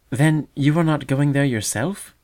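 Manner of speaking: ordinary delivery, nothing marked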